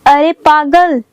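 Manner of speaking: normal talking voice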